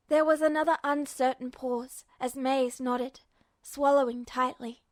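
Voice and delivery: ordinary delivery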